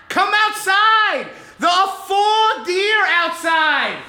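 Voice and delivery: high, slow, loud shrill calls of a New York mom. Retold or imitated. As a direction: yell it out high and drawn out like an excited mother. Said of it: "She's very shrill and loud".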